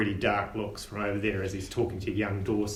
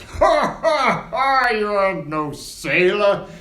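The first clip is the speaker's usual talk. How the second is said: loud, slow laughing bully; mocking brute voice; 8 semitones higher, 8 dB louder, slower than usual. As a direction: jeer like a giant brute. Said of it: "the big giant Flagstaff guy goes".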